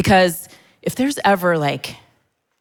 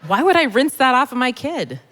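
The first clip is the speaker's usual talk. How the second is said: incredulous; 6 semitones higher than usual. Retold or imitated. Incredulous retort.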